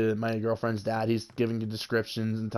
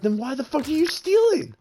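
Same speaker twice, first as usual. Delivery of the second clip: high-pitched, incredulous mock outburst; baffled retort; 3 dB louder than usual. Retelling a confrontation with a would-be thief, baffled and high-pitched. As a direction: throw the question out in high-pitched disbelief. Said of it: "we're completely confused at this point".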